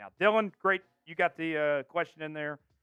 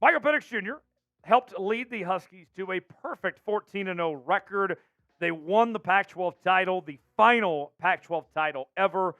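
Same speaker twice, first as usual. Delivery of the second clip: energetic, emphatic sports host delivery; announcer show open; 2 semitones higher, 5 dB louder than usual. Acted. Host welcomes viewers to Seahawks show, energetic announcer. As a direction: punch key words like a show host.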